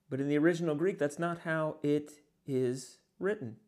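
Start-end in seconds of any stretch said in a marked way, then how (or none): none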